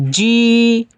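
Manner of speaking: "জি" with the long ee held for one count; steady, elongated "জি"; drawn-out vowel. Shown as a demonstration. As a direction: stretch the vowel in "জি". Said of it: "টানলাম কেন?".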